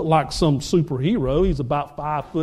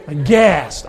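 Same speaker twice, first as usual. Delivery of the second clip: crowd gasp; 4 semitones lower, 4 dB louder than usual. Retold or imitated. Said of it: "the whole crowd just went".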